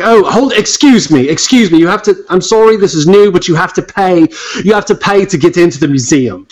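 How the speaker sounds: a flustered British clerk stopping a visitor; lightly done British accent; British museum guard accent. Acted. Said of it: "I'm losing my accent".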